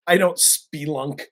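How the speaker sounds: normal talking voice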